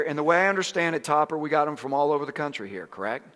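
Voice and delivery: normal talking voice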